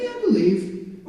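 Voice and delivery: high, noncommittal mimicry; half-hearted agreement. Mimics lukewarm believers answering.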